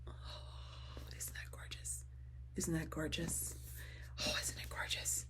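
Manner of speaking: soft breathless whisper of delight; awed whisper. Real, not acted. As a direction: hush it like you can't believe it. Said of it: "Sorry, I'm freaking out".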